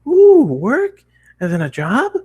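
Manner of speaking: high, excited squeal of interest; a bubbly pony squealing at the word work; curious. Acted. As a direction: perk up, eager and curious. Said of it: "she said curiously".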